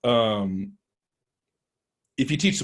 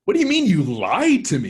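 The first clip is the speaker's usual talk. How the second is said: higher, louder indignant outburst; retold outrage; 8 semitones higher, 6 dB louder than usual. Duped worker confronting the testers, higher indignant outburst. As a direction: play the duped worker, stunned and offended. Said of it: "He was angry".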